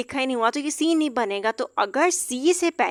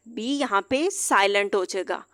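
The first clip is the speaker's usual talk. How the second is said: usual speaking voice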